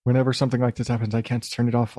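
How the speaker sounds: ordinary talk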